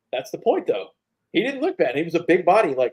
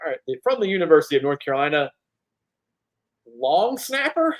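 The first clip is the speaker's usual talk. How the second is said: fast, hyped announcer callout climbing higher and louder; mock announcer intro; 6 semitones higher, 5 dB louder than usual. Host reenacting his Super Bowl experience name call, hyped announcer voice. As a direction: introduce yourself like a stadium announcer, laughing midway.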